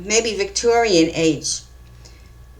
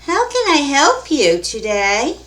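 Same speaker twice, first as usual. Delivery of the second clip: put-on high, slow singsong young girl; high-pitched girlish voice, drawn out; 6 semitones higher than usual. A narrator's reading. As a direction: stretch it out like a young shop girl greeting a customer. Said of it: "A young girl asks".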